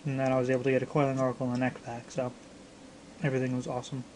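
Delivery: ordinary delivery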